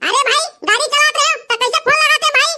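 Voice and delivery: ऊँची, बदली हुई फ़ोन वाली आवाज़ में डीजे वाला; फ़ोन पर बेफ़िक्र डीजे वाला बनकर बोलो; डीजे वाले की आवाज़. Acted. DJ operator on the phone. (दूल्हे के दोस्त से फ़ोन पर बात करता डीजे वाला, ऊँची आवाज़ में)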